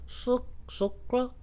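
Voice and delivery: slow, halting put-on voice; second customer. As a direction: sound out the strange word haltingly. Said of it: "another guy comes up to me".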